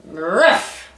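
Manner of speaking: short imitated bark; dog bark. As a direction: bark once like a storybook fox trying his best. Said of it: "gave his best bark".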